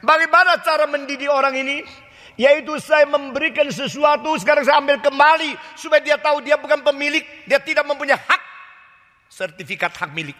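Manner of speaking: God explaining emphatically why he takes back; God reasoning. Acted. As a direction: explain his discipline firmly. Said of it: "Tuhan mengatakan".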